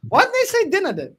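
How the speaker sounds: high, loud, laughing mock complaint; retold protest. Replaying own protest to mum about dinner, high and laughing. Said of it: "I was like".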